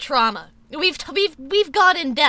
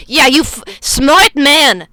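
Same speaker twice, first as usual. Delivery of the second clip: loud; 11 dB louder, slower than usual. Real, not acted. Loud praise for avoiding the word.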